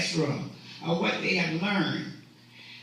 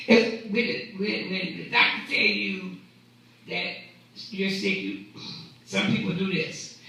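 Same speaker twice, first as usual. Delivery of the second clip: ordinary delivery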